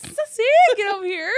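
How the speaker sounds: high, loud dog call through laughter; mock calling out. Mock-calling the dog by its disputed name, loudly laughing. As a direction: call the dog over, cracking up.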